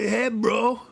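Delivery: lightly voiced Irish stoner; Irish accent. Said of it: "doing an Irish accent".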